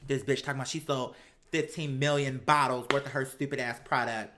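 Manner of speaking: usual speaking voice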